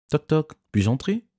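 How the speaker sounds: playful ask. A narrator's reading. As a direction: tease your way in. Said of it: "a demandé Emma".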